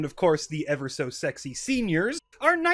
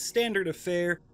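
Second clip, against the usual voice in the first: ordinary talk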